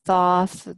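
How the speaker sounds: Thoth as "thawth"; open-vowel pronunciation. Shown as a demonstration. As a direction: say Thoth with an open aw between two th sounds. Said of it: "many different ways of pronouncing his name".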